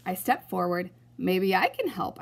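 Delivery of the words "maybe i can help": ordinary delivery, nothing marked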